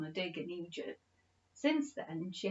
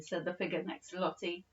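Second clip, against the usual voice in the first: ordinary delivery, nothing marked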